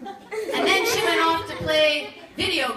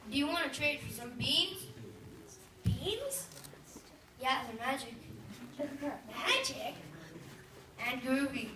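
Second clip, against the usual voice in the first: hippie voice; 3 semitones lower, 9 dB quieter than usual. Acted. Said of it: "weird hippie".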